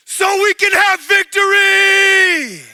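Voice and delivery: loud, high, slow war cry; battle shout. Retold or imitated. Warriors in the dream shout to him, slow and loud. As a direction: roar it like an army behind you. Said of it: "those men behind me go".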